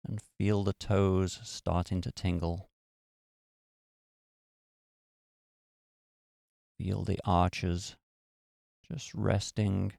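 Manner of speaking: calm, even meditation-guide voice with long pauses; guided meditation. Acted. Podcast host guiding beginners through relaxing each muscle, calm guided meditation. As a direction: ease the listener down, one body part at a time.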